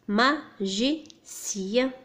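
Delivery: "Magicien" very slowly, ma, gi, cien; syllable by syllable. Shown as a demonstration. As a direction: break "Magicien" into three separate syllables. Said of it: "Troisième syllabe".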